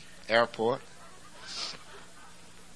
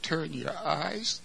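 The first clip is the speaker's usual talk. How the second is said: tender plea; 7 semitones higher, much slower than usual. Acted. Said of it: "He said".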